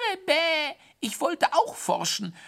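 high, squeaky falsetto excuse; whining hare. A narrator's reading. Hare explaining to his scolder, whining high. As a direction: whine it high like a soggy hare.

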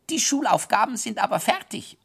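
put-on high, grumpy child voice; sulky high voice. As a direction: sulk it in a squeaky voice. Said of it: "mit missmutigem Gesicht".

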